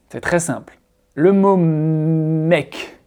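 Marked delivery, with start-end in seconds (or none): 1.6-3.0 "mec" for "make", one long-held pure E; drawn-out French "mec", no glide; wrong single vowel. Shown as a demonstration. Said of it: "il se prononce pas mec".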